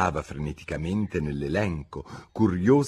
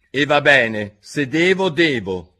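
raised, loud voice of reluctant resignation; dejected king; 6 semitones higher, 7 dB louder, slower than usual. A narrator's reading. King gives in to questioning the witness, dejected. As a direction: give in unhappily, louder and higher. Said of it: "disse il re avvilito".

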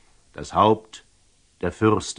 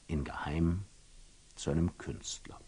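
lower, softer, unvoiced; storyteller hushes for the secret errand; conspiratorial whisper; 3 semitones lower, 11 dB quieter than usual.

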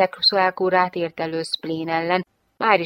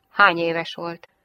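ordinary delivery, nothing marked

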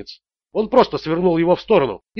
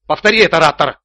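loud, hard command; barked order; 5 dB louder than usual. A narrator's reading. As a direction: bark it at a cowering man. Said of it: "рявкнул Ричард". (рявкая, приказным тоном)

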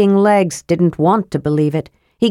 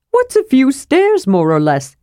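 airy, breezy question, jumping higher; lightly; 7 semitones higher than usual. Acted. Kender to grumpy dwarf, breezy and light. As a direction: shrug it off brightly. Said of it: "Tass asked lightly".

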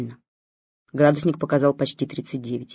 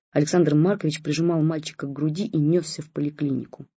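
usual speaking voice

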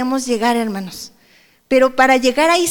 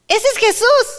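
much higher, rising cry of recognition; excited recognition; 10 semitones higher, 3 dB louder than usual. Retold or imitated. Disciples in the boat recognizing Jesús, excited high cry. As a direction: call out with sudden joy that it is Jesus.

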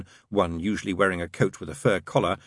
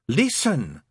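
higher, mildly desperate plea; 6 semitones higher than usual. A narrator's reading. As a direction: plead for attention, a touch desperate. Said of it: "said Crowley desperately".

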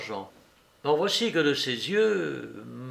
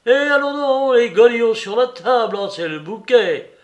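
loud, high outraged shout easing off; crying out; 8 semitones higher, 9 dB louder than usual. A narrator's reading. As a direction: shout in scandalised indignation. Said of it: "Il cria".

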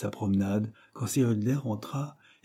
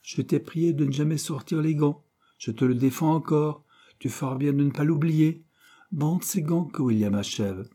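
higher, louder orders; stern manager; 6 semitones higher, 4 dB louder than usual. Acted. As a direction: lay down the rule firmly. Manager forbidding Cyril.

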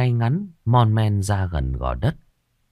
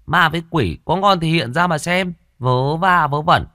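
higher, mocking taunt; raised defiant voice; 7 semitones higher, 3 dB louder than usual. A narrator's reading. Cocky girl taunts the ghosts, raised mocking voice. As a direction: dare the ghosts to show up. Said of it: "Còn Thảo lên giọng".

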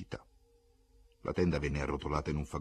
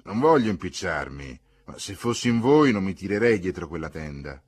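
lightly voiced onlooker, louder; bystander's advice; 2 semitones higher, 11 dB louder than usual. A narrator's reading. Bystander advising the newcomers on the tent, loud lightly voiced advice. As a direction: speak up with unasked advice.

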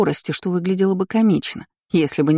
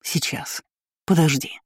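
panting. A narrator's reading. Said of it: "пыхтел блондин".

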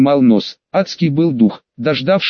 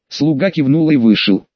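plain reading voice

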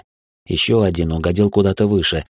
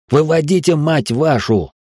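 yelling an order; 7 semitones higher than usual. A narrator's reading. Grabbing men to clear the hall, exasperated yell. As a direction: yell at them to move. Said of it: "заорал я".

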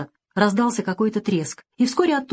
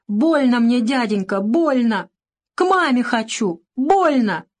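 put-on high, louder whimpering little boy; tearful pleading child; 5 semitones higher, 3 dB louder, slower than usual. A narrator's reading. Boy pleads with the doctor, wailing in pain. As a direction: whine with pain and beg for mama. Hurt boy cries for mother.

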